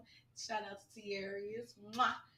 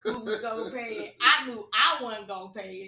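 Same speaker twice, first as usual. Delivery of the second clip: laughing; 2 semitones higher, 12 dB louder than usual. Real, not acted. Laughing about the bill.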